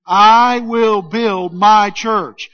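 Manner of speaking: slow, loud, full belief on I; with conviction. Shown as a demonstration. Pastor urges the congregation to believe it, slow and loud. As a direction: say it like you mean every word. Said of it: "Now say it like you believe it".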